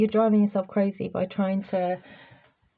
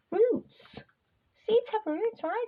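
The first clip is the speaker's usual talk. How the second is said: put-on squeaky high baby talk; puppy voice; 12 semitones higher than usual. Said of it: "that's the voice I use for my puppy".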